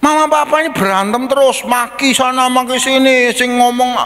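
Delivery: put-on high child's voice telling on parents; a kid exposing what happens at home; tattling child. Anak mengadu orang tua bertengkar. (anak mengadu, suara tinggi)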